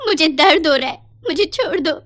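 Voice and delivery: high, loud crying plea; pleading in pain. Acted. Beaten mother begging her husband to stop, crying. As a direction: beg for mercy through tears.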